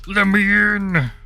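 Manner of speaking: loud, high shout to be let in; pounding on a door, yelling to get in; frustrated yell. Real, not acted.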